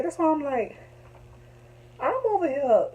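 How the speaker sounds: ordinary talk, nothing marked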